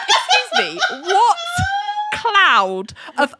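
incredulous rant at a liar; outraged disbelief. Retold or imitated. Host ranting at Ali's lie to Larry, outraged. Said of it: "And I was like".

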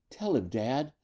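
softly voiced tearful plea; a touch of crying, lightly acted. A narrator's reading. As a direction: plead quietly with a hint of tears. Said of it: "Lena was crying".

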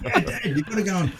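put-on a kid telling dad they've got his car; lightly voiced giggling boy; excited kid. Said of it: "I'm like".

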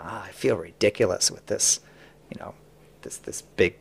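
low, soft retold aside; quiet, low quote of an offhand grumble; muttered passing remark. Retelling man's passing remark.

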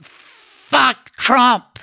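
high, loud yell from a car window; imitated yell. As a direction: holler it like a passing heckler. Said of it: "she yelled".

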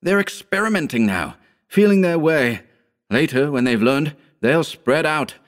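high, alarmed warning; frantic urging. A narrator's reading. Howard warning of the invaders, frantic and high. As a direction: pour out the warning, pitched well up. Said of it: "he urged frantically".

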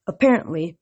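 usual speaking voice

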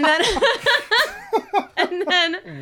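high, helpless laughing while talking; real laughter. Real, not acted. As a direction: try to carry on through the giggles. Cracking up at own mock scolding.

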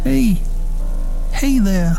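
high, tearful and breaking; voice cracking. A narrator's reading. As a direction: coax the dog with a cracking voice. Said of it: "my voice cracking".

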